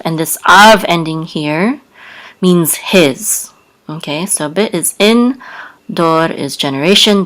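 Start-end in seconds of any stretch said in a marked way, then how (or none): none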